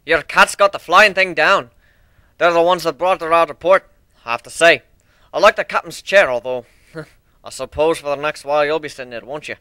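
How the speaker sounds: put-on proud old minotaur. Old minotaur asks Griff about his new airship, proud and eager. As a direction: show off your ship to Griff with pride. Steelhorn praising crew, teasing.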